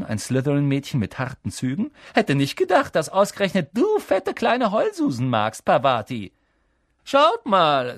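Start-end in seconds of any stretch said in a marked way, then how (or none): 2.1-6.3 high, loud taunt; mocking jeer. A narrator's reading. Classmate jeers at Parvati. 7.0-7.9 high, loud gleeful call; attention-grabbing shout. A narrator's reading. Bully calls others to look.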